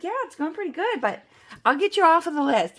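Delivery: airy caller voice signing off; cheerful caller. Retold or imitated. Julie replying on phone.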